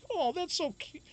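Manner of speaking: high, sweet lady voice; nurse's voice. Retold or imitated. Imitating the nurse cooing at a drugged patient, high. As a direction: coo like a charmed nurse. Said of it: "she's like".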